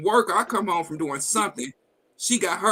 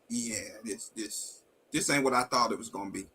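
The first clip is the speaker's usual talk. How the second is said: resigned; 8 semitones lower, 7 dB quieter than usual. Real, not acted. Painful realization about wife.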